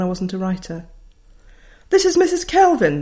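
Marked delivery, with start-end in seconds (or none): 1.9-3.0 high and loud, announcing herself; brisk teacher identifying herself on the phone. Retold or imitated. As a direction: sing out your name like a confident schoolteacher. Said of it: "said the voice".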